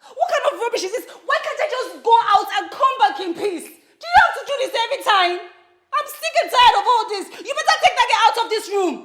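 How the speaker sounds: high, very loud, fed-up shouting; furious rant. Acted. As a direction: let the exasperation boil over at full volume. Wife rants, sick and tired.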